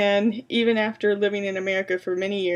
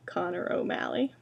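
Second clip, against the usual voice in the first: whisper; 6 dB quieter than usual. A narrator's reading. Monster's final menacing address.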